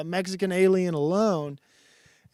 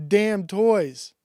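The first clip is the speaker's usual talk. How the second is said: ordinary talk, nothing marked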